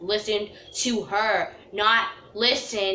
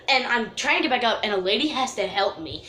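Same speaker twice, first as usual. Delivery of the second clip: ordinary talk, nothing marked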